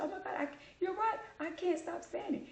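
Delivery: high-pitched apologetic agent impression; imitated phone agent. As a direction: mimic her admitting it, pitched high.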